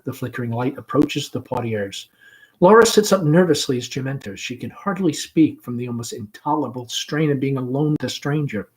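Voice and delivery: usual speaking voice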